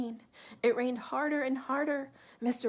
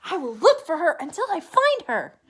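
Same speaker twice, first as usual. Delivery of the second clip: determined Mister Bird; 4 semitones higher, 10 dB louder, faster than usual. A narrator's reading. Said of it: "said Mister Bird".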